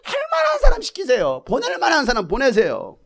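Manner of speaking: high, shouted comic protest; exasperated refusal. Acted. Moses asks the Lord to send someone else, exasperated.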